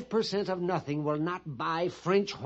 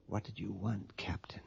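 hushed, secretive whisper; 9 semitones lower, 11 dB quieter than usual. Acted. Pliny asking the captain what he wants, whispering. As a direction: whisper low and very softly. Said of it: "What are you whispering about?".

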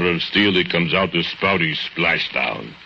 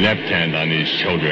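ordinary talk, nothing marked

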